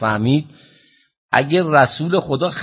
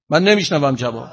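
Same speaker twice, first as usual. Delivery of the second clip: ordinary delivery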